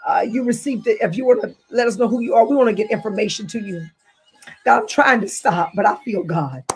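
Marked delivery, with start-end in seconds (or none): 4.6-6.6 a preacher caught up in worship; spirit-filled preaching. Real, not acted. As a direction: let the feeling carry each repeat. Overcome by the spirit.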